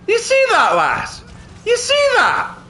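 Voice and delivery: gloating jeer. Pretend. Gloating after enemy flees.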